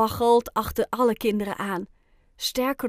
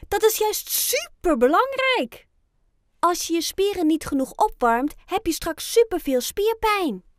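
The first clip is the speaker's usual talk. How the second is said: higher, louder, lively explaining; emphatic insistence; 8 semitones higher, 4 dB louder than usual. A narrator's reading. Friends tell Oscar why warming up matters, emphatic. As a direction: insist on it with real energy.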